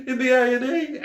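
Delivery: high, squeezed words through real laughter; laughing while talking. Real, not acted. Vlogger cracking up retelling the hospital trip, laughing while talking. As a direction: struggle to get it out between fits of laughter. Can't stop laughing at own story.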